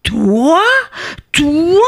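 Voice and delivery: high, stretched, incredulous royal outburst; roar of anger. Acted. King facing the tiny culprit, roar of anger. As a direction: stretch each word in rising outrage. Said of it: "Le roi rugit de colère".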